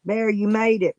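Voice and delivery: ordinary talk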